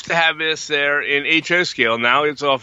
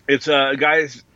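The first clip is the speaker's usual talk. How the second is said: ordinary delivery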